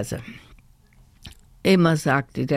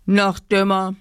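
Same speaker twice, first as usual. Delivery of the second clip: higher, cross put-down; called-out verdict; 4 semitones higher, 4 dB louder than usual. A narrator's reading. Teddy teacher judges the doll, higher cross call. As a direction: call it out sternly. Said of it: "rief er".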